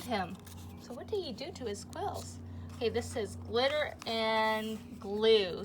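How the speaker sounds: lively storybook reading to a class; reading to children. A narrator's reading. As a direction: read it like story time for little kids. Reads rest of story.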